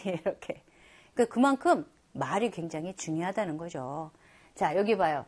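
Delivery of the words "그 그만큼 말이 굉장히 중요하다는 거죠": ordinary talk, nothing marked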